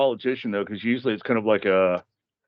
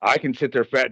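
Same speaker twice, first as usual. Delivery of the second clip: ordinary talk, nothing marked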